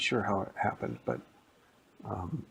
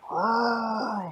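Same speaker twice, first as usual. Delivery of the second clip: high loud roar from the trees; monster roar; 13 semitones higher, 6 dB louder than usual. Acted. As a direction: roar like a giant juice monster. Monster heard from the forest.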